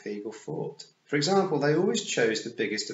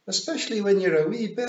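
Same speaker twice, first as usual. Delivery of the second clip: high, loud Scots pictsie; Daft Willie; 11 semitones higher, 8 dB louder than usual. A narrator's reading. Daft Willie chipping in with a grin, high Scots voice. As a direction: blurt it in a silly high Scots voice. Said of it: "said Daft Willie".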